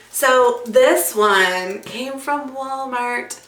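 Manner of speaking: normal talking voice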